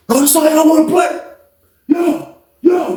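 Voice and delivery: high, worked-up outburst; angry challenge. Retold or imitated. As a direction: call everyone out. Acts out angry victim.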